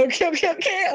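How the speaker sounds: high, stunned okay, okay, okay; overwhelmed okay. Retold or imitated. To the two men's offer, a stunned high-pitched okay. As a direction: squeak it out, caught off guard.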